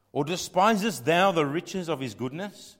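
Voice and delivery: thundered scripture. Acted.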